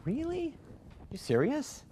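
high, mock-surprised disbelief; a squeaky, disbelieving "really?"; incredulous God. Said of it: "And go".

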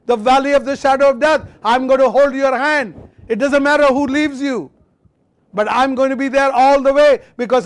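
high, loud, ringing voice of God; voicing God's truth. Pastor voicing God's promise to the discouraged, high and loud. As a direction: proclaim it as God speaking, lifting. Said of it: "The truth says".

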